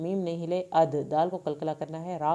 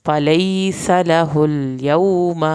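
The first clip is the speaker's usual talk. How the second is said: slow, louder recited word; stretched tajweed recitation; Quran recitation; 9 dB louder, much slower than usual. Shown as a demonstration. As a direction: chant it out slowly with a full heavy ṭā. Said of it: "سین کی زبر کو لمبا نہیں کرنا".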